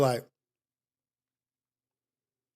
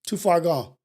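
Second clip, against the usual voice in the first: defeated self-talk. Retold or imitated. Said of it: "I would look at myself in the mirror and be like".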